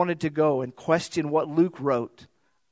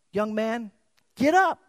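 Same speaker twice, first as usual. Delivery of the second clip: commanding call; 6 semitones higher than usual. Pretend. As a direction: raise him with authority. Jesus commands the dead man.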